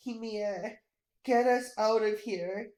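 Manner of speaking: ordinary delivery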